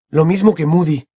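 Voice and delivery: ordinary delivery, nothing marked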